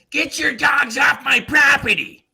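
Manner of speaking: high, loud mock yell from the window; angry shout. Neighbor yells at dog walker from her window, a loud high shout. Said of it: "the way you did that".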